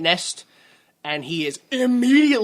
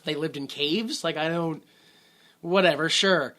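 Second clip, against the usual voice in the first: usual speaking voice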